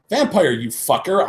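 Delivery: angry insult. Acted. Insulting while slapping him.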